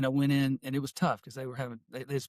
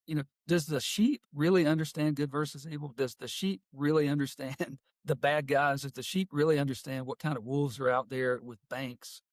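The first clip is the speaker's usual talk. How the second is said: usual speaking voice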